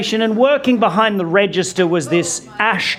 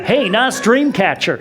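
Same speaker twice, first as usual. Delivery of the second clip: ordinary talk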